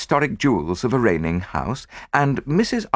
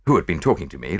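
ordinary delivery

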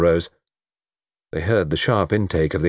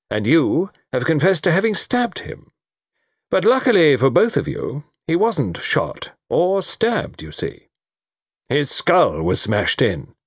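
high, deliberate reveal, slowing at the end; spring the trap slowly and emphatically; emphatic colonel's summing-up; triumphant colonel; 8 semitones higher than usual. Acted.

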